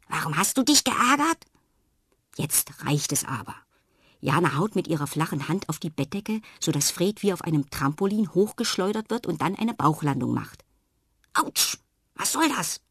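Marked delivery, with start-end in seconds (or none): 0.1-1.4 higher, louder mocking parrot; Fred mimics her question; mocking echo. A narrator's reading. 11.3-12.8 high indignant clown voice; tiny clown protests after the fall; indignant ouch. A narrator's reading. Fred after bellyflop.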